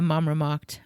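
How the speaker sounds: ordinary delivery, nothing marked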